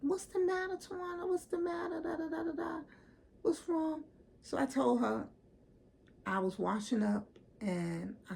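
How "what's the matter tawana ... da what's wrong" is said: high, mock-sweet concerned friend; friend's voice. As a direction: pitch it way up, fake sympathy. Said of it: "she was like".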